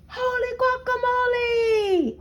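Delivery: high shouted cry of surprise; startled exclamation. A narrator's reading. Kitten startled by his mirror, shouting high. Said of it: "exclaimed Skippy John Jones".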